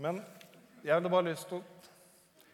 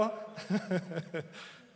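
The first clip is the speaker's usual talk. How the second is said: real chuckling laugh; 6 semitones higher than usual. Real, not acted. Speaker teasing while the verse is looked up, chuckling laugh. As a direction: laugh it out, then let it settle.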